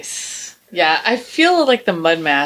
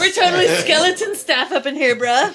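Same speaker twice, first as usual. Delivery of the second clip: dude bro voice; 8 semitones higher than usual. Retold or imitated. Mocking bar staff.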